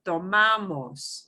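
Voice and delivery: Tomamos slowly, with MA stretched and punched; stress on second-to-last syllable. Shown as a demonstration. Teacher showing the second to last syllable, stressed MA in Tomamos. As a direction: lean on the MA in Tomamos. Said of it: "Here's a second to last syllable".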